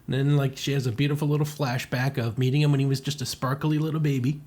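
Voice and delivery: ordinary talk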